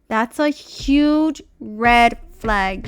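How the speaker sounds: slow drawn-out that's a huge red flag with huge leaned on; stress on huge. Real, not acted. Warning viewers about the dealership, slow and emphatic. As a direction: stretch out huge to warn them.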